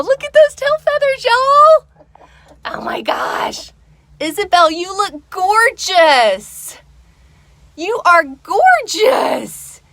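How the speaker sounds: very high, slow doting coo; high sing-song admiration; admiring a pet. Real, not acted. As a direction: gush over the pretty hen. Admiring her hen Isabel.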